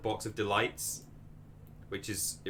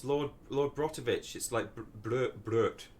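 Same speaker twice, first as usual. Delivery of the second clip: put-on German accent; slower than usual. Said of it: "My German accent's not very good".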